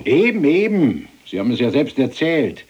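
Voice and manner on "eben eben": laut und gedehnt höhnend; triumphierend, langsam spotten; höhnisch. Acted. Spöttischer Streit mit dem General. (laut höhnend, langsam)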